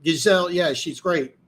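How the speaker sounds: usual speaking voice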